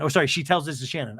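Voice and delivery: normal talking voice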